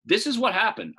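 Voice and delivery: usual speaking voice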